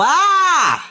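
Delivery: short high yell aimed off the mic; a quick yell turned to the side; sharp high cry past the microphone; sideways shout. Shown as a demonstration. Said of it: "Si quiero gritar, le grito de lado".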